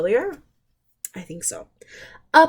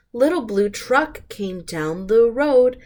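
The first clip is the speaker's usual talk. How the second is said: ordinary delivery, nothing marked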